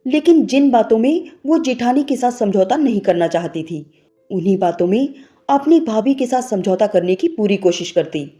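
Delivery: ordinary delivery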